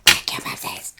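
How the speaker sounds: loud emphatic whisper; whisper shouting. Real, not acted. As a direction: hiss it out with force. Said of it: "I'm whisper shouting again".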